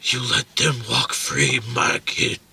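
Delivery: slow breathy whisper; movie whisper. Retold or imitated. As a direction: hush the grievance out slowly. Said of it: "a breathy whisper".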